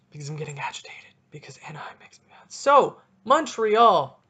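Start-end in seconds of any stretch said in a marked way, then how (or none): 0.1-2.5 fast, hushed whisper; whispered aside. Real, not acted. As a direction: whisper it quickly to the camera. Says he is getting agitated.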